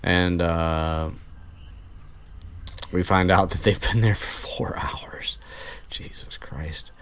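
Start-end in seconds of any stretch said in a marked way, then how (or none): 2.9-6.9 whispered disbelief; shocked whisper. Real, not acted. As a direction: hush it, stunned. Shocked aside while retelling.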